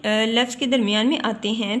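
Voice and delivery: ordinary talk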